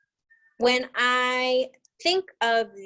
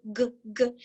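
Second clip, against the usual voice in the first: ordinary delivery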